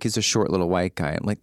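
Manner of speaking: ordinary talk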